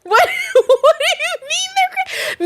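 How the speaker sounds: high, slow, laughing protest; laughing disbelief. Real, not acted. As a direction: laugh through the words.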